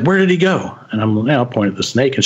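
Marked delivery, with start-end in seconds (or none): 0.0-0.7 high-pitched, acted urgent demand; urgent retold question. Mom asking her child where he went, high and urgent. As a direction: imitate a startled mom, voice jumping up.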